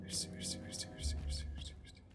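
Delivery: tense unvoiced whisper, sneaking past. Real, not acted. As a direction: coax yourself through in a whisper. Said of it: "آروم". (نجوای پرتنش)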